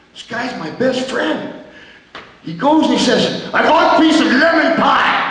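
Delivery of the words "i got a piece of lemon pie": shouting order. Retold or imitated. Wrestler shouting for pie.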